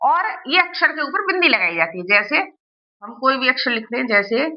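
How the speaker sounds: ordinary talk, nothing marked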